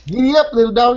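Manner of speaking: over-the-top mock Texan cowboy. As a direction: ham up a cowboy calling his cattle. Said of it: "That's Texas".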